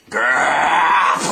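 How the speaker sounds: loud high growl; loud furious growl; angry growl. Acted. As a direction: growl with rising fury. Frank explodes in rage.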